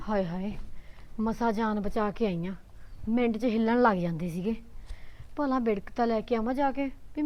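soft, low grumbling aside; muttering to herself. Acted. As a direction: grumble under your breath, for yourself alone. Muttering to herself after escaping.

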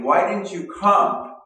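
high, loud, pleading cry of grief; Martha's anguished plea. Retold or imitated. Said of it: "I picture Martha coming to, to Jesus saying".

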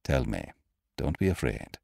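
low, soft doctor's voice; gentle reassurance. Acted. Doctor urging a frightened woman to speak, low and soothing. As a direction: softly coax a frightened patient.

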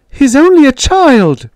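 high, loud, desperate shriek; mother shrieking at the father. Retold or imitated. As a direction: scream it to stop the beating. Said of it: "shrieking at him".

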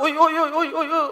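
lightly played panic; mock alarm. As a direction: act out someone feeling the ground shake. Acting people feeling an earthquake.

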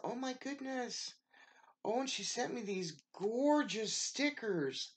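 high squeal of surprise; thrilled exclamation; delighted surprise. Real, not acted. Finding more gifts.

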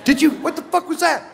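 startled shout. Pretend. Startled reaction to ghost.

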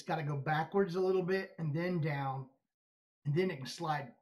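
talking while fitting dentures; fingers in mouth. Real, not acted. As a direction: lips pulled by fingers. Seen 2: both hands at mouth corners fitting dentures, 2.4–6.6s, while talking.

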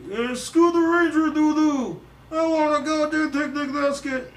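very high, loud cartoon bear; high-pitched cartoon bear holler; Yogi Bear. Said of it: "I can do Yogi Bear".

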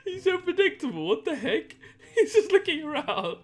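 high, giggly talk; laughing. Real, not acted. Troll laughing at his oblivious target, amused. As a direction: talk through real giggles.

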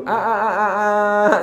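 drawn-out "aah" as when spoon-feeding; coaxing "aah". Retold or imitated. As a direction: imitate a parent getting a child to open up. Imitates coaxing a child to open mouth.

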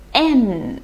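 "n" as a letter name, "em", closing the lips; name the letter "n" as "em", drawn to a hum; letter name demo. Shown as a demonstration. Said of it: "那这个字母是". (读字母名“n”，读作em，最后闭嘴)

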